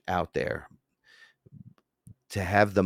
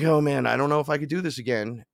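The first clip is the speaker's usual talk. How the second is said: higher, louder despairing cry; 7 semitones higher, 3 dB louder than usual. Retold or imitated. A man recalls his bad trip, half-wailing. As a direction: wail it like you're done with this. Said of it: "I was like".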